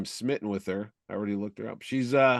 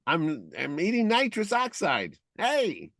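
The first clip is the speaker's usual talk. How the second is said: higher, squeaky gas-voice; helium-like squeaky voice; 7 semitones higher than usual. Pretend. Host joking he ate nitrous oxide, squeaky high voice. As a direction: sound like you huffed nitrous. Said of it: "you got Eddie Deason's voice now".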